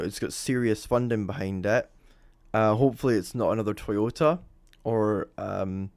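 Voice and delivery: usual speaking voice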